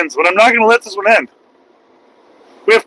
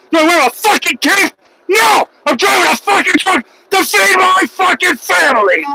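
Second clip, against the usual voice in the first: rising into a higher full yell, real anger; furious shout; 10 semitones higher than usual. Real, not acted. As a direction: blow up at the troll, all out. Furious rant at a chat troll.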